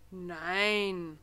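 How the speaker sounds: a firm, stern no. Acted. Petrus refusing the Christ child, stern. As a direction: shut it down firmly. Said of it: "sagt Petrus streng".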